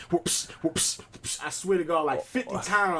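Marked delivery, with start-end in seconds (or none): 0.1-1.4 whispered imitation of belt smacks; breathy whipping sound effects; belt whoops. A dad mimics the belt lashes he heard upstairs, whispered. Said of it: "All you hear is whoops".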